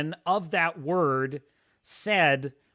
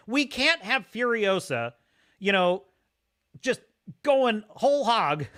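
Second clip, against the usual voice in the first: mocking sarcasm; 6 semitones higher, 4 dB louder than usual. Real, not acted. Mocking corporate prudishness.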